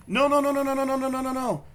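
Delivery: rapid high-pitched no, no; frantic no. Real, not acted. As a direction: cut it off in a high panic. Reacting to clip.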